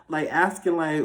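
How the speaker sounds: usual speaking voice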